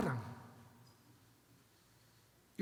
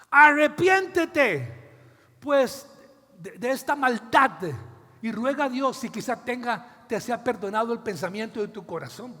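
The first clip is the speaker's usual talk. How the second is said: angry call to repent; 4 semitones higher, 11 dB louder than usual. Retold or imitated. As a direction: command him to repent, furious. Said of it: "se enojó Pedro". (ordena arrepentirse a gritos, con enojo)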